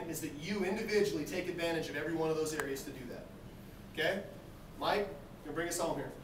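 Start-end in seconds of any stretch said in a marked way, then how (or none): none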